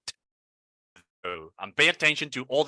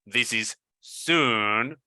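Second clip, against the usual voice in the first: "This is soon" read slowly, every sound clear; slow model reading; much slower than usual. Shown as a demonstration. Teacher reads example slowly for the class. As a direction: stretch each word out for learners. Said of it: "Let's see here".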